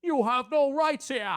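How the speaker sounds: loud, high, hammed-up movie hero outrage; mock dramatic shout; Charlton Heston. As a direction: bellow it like an overacted film hero. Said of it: "like Charlton Heston in Planet of the Apes".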